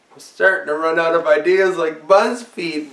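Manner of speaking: ordinary talk, nothing marked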